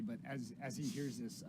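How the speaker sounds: ordinary delivery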